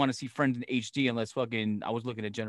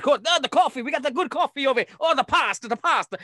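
high, eager tour-guide voice, pitched way up; excited Italian host; 11 semitones higher, 5 dB louder than usual. Retold or imitated. Mimicking Stanley Tucci showing off the market beans, high and eager. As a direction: hype up the beans like a gushing host. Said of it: "he drops it in".

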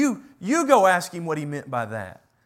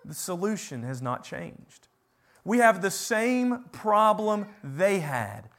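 ordinary delivery

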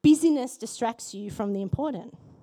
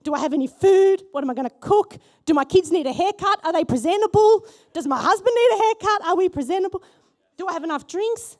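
higher, louder, rushing worried questions; frantic hosting panic; 6 semitones higher, 6 dB louder than usual. Pretend. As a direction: panic like Jesus is coming to lunch. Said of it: "The things that would be going through my head".

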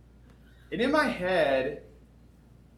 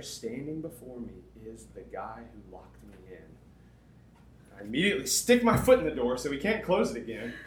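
usual speaking voice

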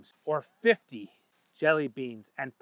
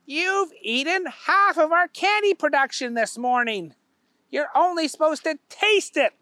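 high, raised boss voice; higher stern boss; scolding boss; 11 semitones higher, 3 dB louder than usual. A narrator's reading. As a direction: scold the worker. Owner scolds.

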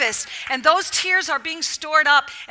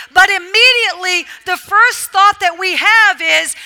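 loud, higher preacher's proclamation; full-force preaching; 5 semitones higher, 8 dB louder, slower than usual. Acted. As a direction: drive it home to the congregation, stretching key words.

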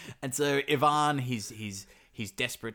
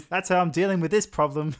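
higher, louder voice of a clueless hero; mock dopey hero; 8 semitones higher, 9 dB louder than usual. Teller mocking Ivan who sleeps, in a higher, louder hammed-up voice. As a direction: ham up the lazy hero's excuse.

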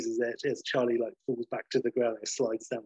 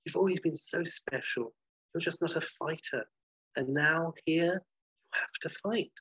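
higher, sugary mother voice, creepy underneath; eerie sweet mother; 5 semitones higher than usual. Retold or imitated. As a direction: coo like a sinister mother. Mother voiced in retold dream.

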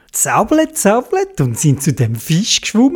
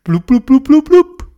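bubbling sounds; 9 semitones higher, 4 dB louder than usual. A narrator's reading. Bubbles rising underwater.

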